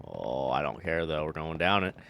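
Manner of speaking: ordinary delivery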